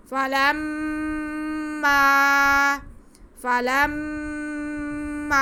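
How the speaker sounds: فلما with a nasal hum on م, then a long ا; slow recitation of فلما; ghunna then madd. Shown as a demonstration. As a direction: hold the hum, then stretch the alif. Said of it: "پہلے ایک الف کے برابر غنہ کریں گے".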